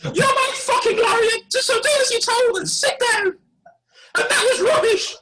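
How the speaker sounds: a hammed-up, shrieking monarch turned rapper; the Queen. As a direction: play a foul-mouthed Queen grabbing the mic. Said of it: "who should rock up but Her Majesty the Queen".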